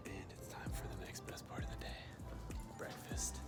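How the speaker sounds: soft unvoiced whisper. Real, not acted. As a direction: whisper it like a secret.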